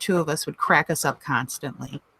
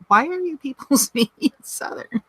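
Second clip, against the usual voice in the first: higher, laughing incredulous question; amused disbelief; 8 semitones higher than usual. Pretend. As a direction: ask it in baffled amusement. Joking disbelief at downstate accents.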